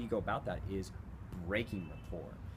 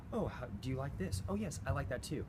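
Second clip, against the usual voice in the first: agreeable small talk; 6 semitones higher than usual. Retold or imitated. Mimics small talk building rapport.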